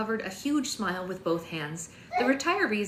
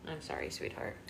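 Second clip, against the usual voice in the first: hushed apology; 11 semitones lower, 10 dB quieter than usual. Real, not acted. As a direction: breathe it tenderly.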